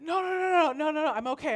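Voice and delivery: high, loud string of noes; hasty refusal. Retold or imitated. Babysitter reassuring child she is okay, hasty refusal.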